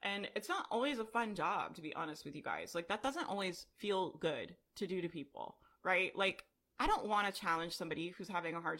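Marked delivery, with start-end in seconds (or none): none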